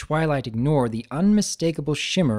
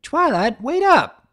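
much higher, louder calling out; calling after; 13 semitones higher, 6 dB louder, slower than usual. Acted. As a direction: call to a friend running away. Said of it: "Applejack called after her".

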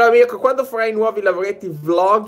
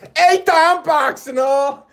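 mock-innocent joking tone, higher; joking punchline; 9 semitones higher, 4 dB louder, slower than usual. Real, not acted. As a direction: land the pun with a slow grin. Punchline joke, higher and slower.

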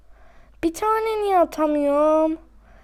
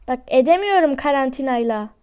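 ordinary talk, nothing marked

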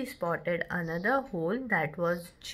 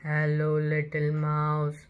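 growled bear; 6 semitones lower than usual. A narrator's reading. Huge bear greeting Little Mouse, low growl. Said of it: "growled a huge bear".

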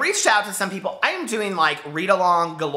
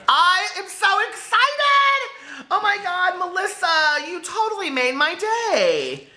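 high, slow squeal with a laugh; excited squeal; 11 semitones higher, slower than usual. Real, not acted. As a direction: gush with thrilled gratitude. Thrilled over the bookmarks.

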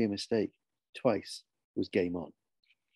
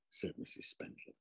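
usual speaking voice

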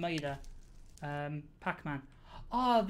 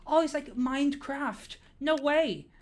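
high, loud, drawn-out amazement; amazed exclamation; 7 semitones higher, 6 dB louder, slower than usual. Pretend. Streamer seeing a Minecraft build, hammed-up amazement. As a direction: gush slowly in over-the-top wonder.

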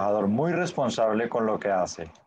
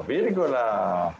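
"vírgula" drawn out slowly, syllable by syllable; slow repetition; 2 semitones lower, much slower than usual. Shown as a demonstration. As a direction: repeat the word slowly for the class. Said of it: "teacher repeats answer slowly".

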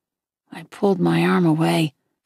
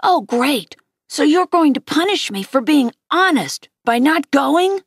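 higher-pitched sarcastic protest; indignant yelling; 7 semitones higher than usual. A narrator's reading. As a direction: sister protesting to Mom, voice lifted. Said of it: "Via yelled at Mom".

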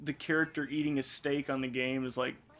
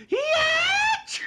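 shrieking laughing yell, very high and loud; laughing scream of a retold grandma; screamed quote; 11 dB louder than usual. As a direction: scream it at the top of your lungs. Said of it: "she literally screams at the top".

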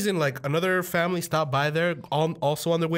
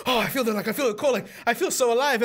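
higher, giddy thrill; mock exhilaration; 7 semitones higher, faster than usual. Boy speeding downhill on a sled, exhilarated. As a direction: let the pitch ride up with delight.

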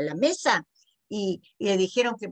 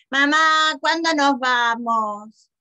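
put-on loud, drawn-out child's whine; whining child; 3 semitones higher, 6 dB louder, much slower than usual. A narrator's reading. Child wanting to leave the party, loud slow whine. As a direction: drag it out, nagging mum. Bored child nagging mother in story.

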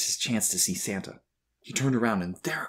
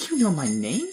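put-on high, louder, excited boy's question; excited asking; 7 semitones higher, 5 dB louder than usual. A narrator's reading. Boy amazed that Santa knows him, excitedly. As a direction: ask it in eager surprise. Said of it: "he asked excitedly".

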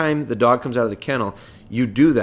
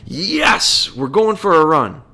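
high, louder burst of mock excitement; excited dog; 4 semitones higher, 4 dB louder, slower than usual. Dog as the endorphins go off, excited. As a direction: play a dog thrilled and raring to go. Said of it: "endorphins go off in their head".

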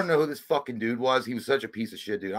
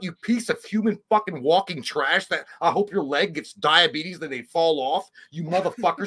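mock furious rant; 6 semitones higher than usual. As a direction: tear into him with mock outrage. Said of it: "I'm like".

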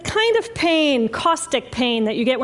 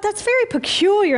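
higher-pitched puzzled thought; puzzled inner thought; 6 semitones higher than usual. Acted. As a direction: think aloud, pitched up in wonder. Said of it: "And I thought".

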